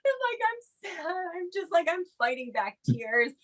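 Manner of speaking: real tearful crying while speaking; choked-up, tearful telling. Real, not acted. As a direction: voice breaking high into tears. Breaks into tears retelling moment.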